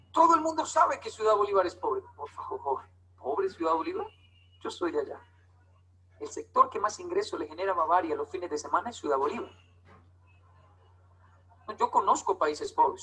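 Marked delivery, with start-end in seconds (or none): none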